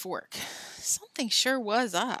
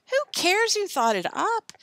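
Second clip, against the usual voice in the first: high, loud, indignant shout; 12 semitones higher, 5 dB louder than usual. A narrator's reading. Tom cuts into Alan's blaming, indignant shout. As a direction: shout it dismissively, pitched high. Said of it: "shouted Tom".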